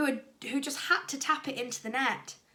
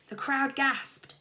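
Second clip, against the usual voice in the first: plain reading voice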